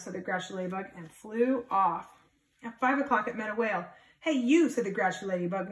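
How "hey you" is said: high, loud hey you; challenging call. Acted. Grouchy ladybug calling out a bigger animal, loud and high. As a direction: call out to pick a fight. Grouchy bug picks a fight.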